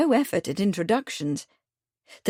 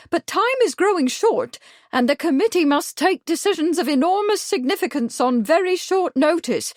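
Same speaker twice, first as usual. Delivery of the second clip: higher, excited busybody voice; fussy village lady; 6 semitones higher, 3 dB louder than usual. A narrator's reading. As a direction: gush with self-important urgency. Truebody announces royal visit.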